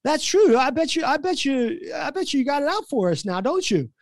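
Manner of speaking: high-pitched teammate piling on; Steve Blake. Retold or imitated. Teammate joining the accusation against the coach, high and goading. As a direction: pile on with high, mock suspicion. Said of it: "now Steve Blake's in it".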